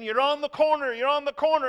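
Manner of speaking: imitating a frantic passenger, very high and loud; high-pitched retold shriek of alarm; panicked screaming. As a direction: scream a warning at the driver.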